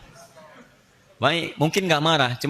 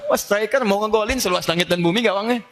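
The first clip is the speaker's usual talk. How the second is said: ordinary delivery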